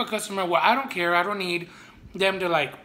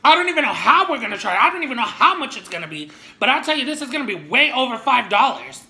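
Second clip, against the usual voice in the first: mimicking the manager; 5 semitones higher, 5 dB louder, faster than usual. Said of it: "She even said".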